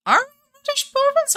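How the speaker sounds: very high, squeaky-pitched incredulous question; mock disbelief. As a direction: query it in a sky-high voice of mock outrage. Mock disbelief at a viewer's claim.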